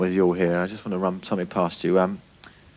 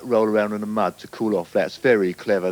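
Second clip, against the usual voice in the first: usual speaking voice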